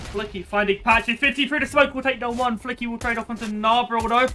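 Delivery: loud, fast live play-by-play; esports caster. Acted. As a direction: call the action as it happens.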